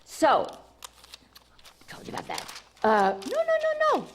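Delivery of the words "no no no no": playful call back. Real, not acted. Calling viewers back playfully.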